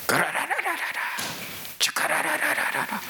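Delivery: rapid high rolling babble; speaking in tongues. Shown as a demonstration. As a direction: rattle off tongues fast and high. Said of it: "聖霊様がね、皆さんの舌を通って声を出す".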